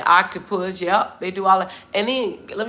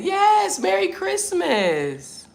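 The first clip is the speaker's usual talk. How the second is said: sing-song greeting; 12 semitones higher, much slower than usual. Real, not acted. Greeting viewer on live stream.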